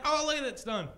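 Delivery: high, loud smug showing off; mock the smug guy who just wrote; mock smug pen guy. Mocking the guy with a pen behind his ear, high smug bragging.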